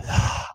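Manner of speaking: acted sigh of giving in; resigned sigh. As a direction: let out a breath before conceding. Retells giving in to the deal.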